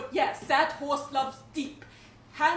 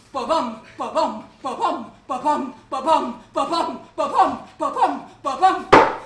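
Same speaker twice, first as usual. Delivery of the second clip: heartbeat thumps growing louder; building heartbeat imitation; 6 dB louder than usual. Performer voicing a huge tender heart, building heartbeat. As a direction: pound out each ba-bum, building to a loud finish.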